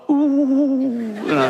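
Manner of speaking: high, swooping mock gasp; mock the tabloid outrage; high sarcastic ooh. Mocking media outrage.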